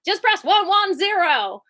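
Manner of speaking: mock breezy staffer. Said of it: "They're like".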